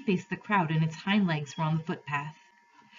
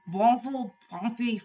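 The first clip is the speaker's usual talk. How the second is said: muffled, higher-pitched nonsense words; voice inside a hat; 5 semitones higher, 3 dB louder, slower than usual. A narrator's reading. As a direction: mumble as if a hat covers your face. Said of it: "voice from inside the hat".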